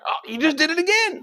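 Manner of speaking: very high-pitched mock gotcha; gleeful accusation. Accusers catching Peter out, in a high accusing squeal. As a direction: pounce on the slip, voice way up.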